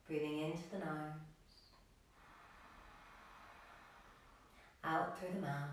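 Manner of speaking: soft, low-key yoga meditation voice with long pauses; guided meditation. Acted. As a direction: gently guide the class into stillness, leaving room to breathe. Leads a breathing meditation.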